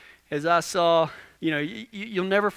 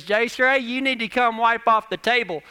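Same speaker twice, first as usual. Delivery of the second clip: wife calling out; 6 semitones higher, 5 dB louder than usual. Retold or imitated. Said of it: "she goes".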